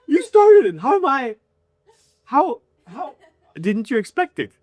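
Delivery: high, loud indignant comeback; indignant retort. Retold or imitated. Retold quarrel with a heckler.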